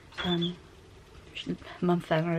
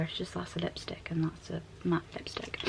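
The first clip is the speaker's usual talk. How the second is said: whispering; 6 dB quieter than usual. Real, not acted.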